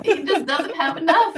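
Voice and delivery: laughing mock pity. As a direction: pity him in jest, laughing. Mocking the Pope's poverty.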